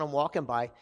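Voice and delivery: normal talking voice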